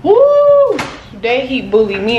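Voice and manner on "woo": normal talking voice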